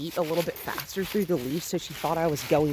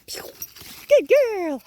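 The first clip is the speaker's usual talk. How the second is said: much higher and louder, slow praise; happy praise; 8 dB louder than usual. Real, not acted. Trainer praising the puppy for chasing the toy. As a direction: cheer her on.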